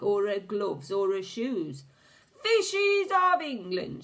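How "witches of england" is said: even higher, loud, drawn-out yell; witch's yell. A narrator's reading. The Grand High Witch repeats her call, yelling higher. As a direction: yell it higher the second time. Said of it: "she yelled".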